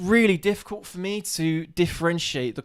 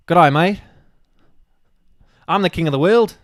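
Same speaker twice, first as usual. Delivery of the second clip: loud, higher, badly faked Australian; attempted Australian accent; 4 semitones higher, 10 dB louder than usual. Player having a go at a film quote, loud shaky-footed Australian accent. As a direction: boom it out in a hammed-up Australian accent. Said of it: "is that how Australians say world".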